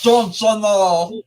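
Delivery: drawn-out cartoon-alien Wisconsin accent; slow, silly alien take on Wisconsinite; Stitch. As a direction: stretch it out in a creature voice. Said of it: "your Stitch Wisconsinite".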